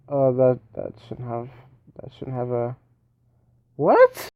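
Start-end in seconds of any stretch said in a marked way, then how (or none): 3.8-4.3 very high, loud squeaky what; shocked what. Real, not acted. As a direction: shriek the question in disbelief. Sudden game surprise.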